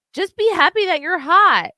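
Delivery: loud, high, fed-up outburst; exasperated shout. Real, not acted. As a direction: yell it in exasperation. Exasperated outburst at hot girls.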